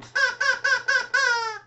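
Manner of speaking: very high squeaky puppet cackle; a bird puppet's shrill chuckle; high cartoon bird laugh; puppet squawk laugh.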